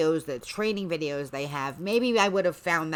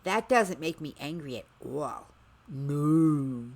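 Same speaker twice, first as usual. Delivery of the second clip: dry deadpan sarcasm; sarcastic; 3 semitones lower, slower than usual. Acted. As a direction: say it flat, meaning the opposite. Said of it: "I am fluent in sarcasm also".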